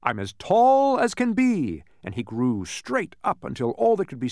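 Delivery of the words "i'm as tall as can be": high, loud, drawn-out boast; stretched-up boast. A narrator's reading. Man growing straight up, stretched-up boast. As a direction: stretch TALL out long and high.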